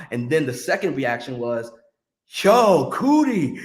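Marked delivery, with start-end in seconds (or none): 2.4-3.6 loud, slow drawn-out excited cheer. Retold or imitated. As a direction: call out like everyone's congratulating you. Crowd cheers teller after talk.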